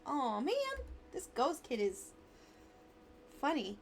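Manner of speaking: ordinary talk, nothing marked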